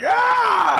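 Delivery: sudden high shouted "Ah!"; high scream. Retold or imitated. Retelling the movie tranq scene, a high shouted scream. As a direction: let out a shrill shot yelp. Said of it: "He's like".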